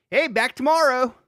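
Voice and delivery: high, loud mock radio cheer; mock sign-off. Imitating a co-host signing off till tomorrow, loud mock cheer. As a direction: call it out like an upbeat host.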